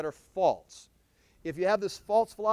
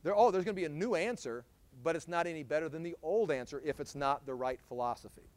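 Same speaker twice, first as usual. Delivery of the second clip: ordinary delivery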